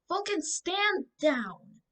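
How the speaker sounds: high, loud, slow command; girl commanding her dragon; yelled command. A narrator's reading. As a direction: order the dragon to stop. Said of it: "someone yell".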